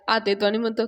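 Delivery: ordinary delivery, nothing marked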